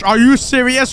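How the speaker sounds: ordinary talk, nothing marked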